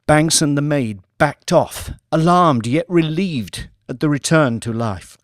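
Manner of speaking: ordinary delivery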